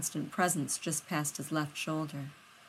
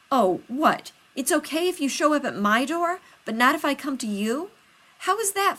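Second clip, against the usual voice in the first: louder and higher, affronted; indignant outburst; 9 semitones higher, 8 dB louder than usual. Acted. Rodney to John at the door, indignant and loud. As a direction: throw the unfairness back at him.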